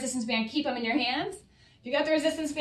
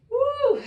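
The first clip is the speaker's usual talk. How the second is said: high, loud "woo"; high-pitched cheer of exertion; effort whoop; 13 semitones higher, 6 dB louder than usual. Real, not acted. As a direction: let out a big workout whoop. Cheer during a hard set.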